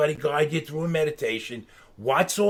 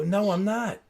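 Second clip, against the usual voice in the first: higher-pitched mock protest; retold stubborn comeback, pitched up; insistent refusal; 7 semitones higher than usual. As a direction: dig in and refuse, pitch rising. Retold back-and-forth refusal, escalating.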